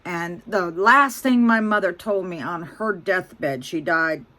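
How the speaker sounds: ordinary talk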